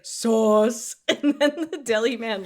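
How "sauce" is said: blunt loud single word; flat one-word reply. Retold or imitated. Rich answering Jen about the sauce, blunt and loud. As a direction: answer like you missed the question. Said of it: "He's like".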